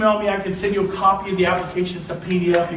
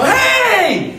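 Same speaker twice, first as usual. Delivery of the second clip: playful shout; 13 semitones higher, 7 dB louder than usual. Retold or imitated. Calling back at driver.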